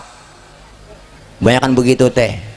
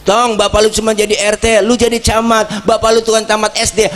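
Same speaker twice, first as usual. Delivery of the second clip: higher, driving father's push; firm old-school father; 6 semitones higher than usual. Retold or imitated. Old-school father pushing his son to study and rise higher, insistent. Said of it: "Lihat orang tua dulu".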